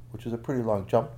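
ordinary delivery, nothing marked

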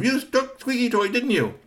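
high, loud accusation; accusing yell. Acted. Boy yells at Tony about Squeaky Toy, loud. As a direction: shout it at him. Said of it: "started to yell at him".

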